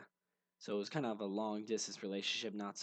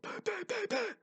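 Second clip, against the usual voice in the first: high, strained gasping bahs; mock wheezing gasps; 5 dB louder than usual. Kid mocking his own asthma wheeze, high strained gasps. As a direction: gasp out bahs like a kid who can't breathe. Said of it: "We'd be like".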